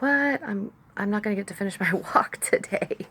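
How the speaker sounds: high disbelieving "What?" trailing into a laugh; mock dismay. Woman retelling her dismay at the cut-short walk. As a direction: play your disappointment, half laughing. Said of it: "I was like".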